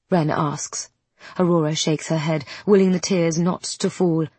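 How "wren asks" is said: ordinary delivery, nothing marked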